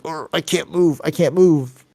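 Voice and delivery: high, strained imitation of being pinned; mock struggling. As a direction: play someone helplessly stuck in a hold. Voices a pinned opponent struggling.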